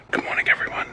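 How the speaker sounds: hushed whisper so nobody wakes. Real, not acted. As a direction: whisper it softly at dawn. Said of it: "so I'm being really quiet".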